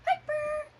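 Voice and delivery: high, loud call of the dog's name. Real, not acted. Calling the puppy by name.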